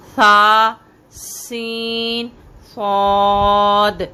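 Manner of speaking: सा, सीन, सौद held long, each distinct; contrasting letters. Shown as a demonstration. Teacher contrasting similar letters slowly. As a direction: stretch and contrast the three. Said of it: "इन तीनों की आवाज़ें जो हैं आपस में मिलती जुलती".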